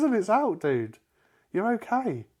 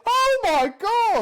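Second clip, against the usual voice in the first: drawn-out, high laughing cry; exasperated shriek; 5 dB louder, much slower than usual. Real, not acted. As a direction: wail it loudly in disbelief. Exasperated argument about tongue.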